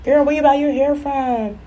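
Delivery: high, slow gushing stranger voice; mock-gushing high voice; nosy stranger. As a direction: coo over the hair like a nosy fan. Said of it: "direct their energy to me".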